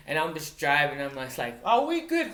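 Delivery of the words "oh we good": very high, loud placating reply; mock reassurance. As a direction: act out brushing off the boss in a high voice. Retold reassurance to angry boss.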